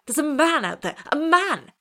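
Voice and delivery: high, loud cry of alarm; frantic cry. A narrator's reading. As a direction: shout it in horrified panic. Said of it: "cried Wilson".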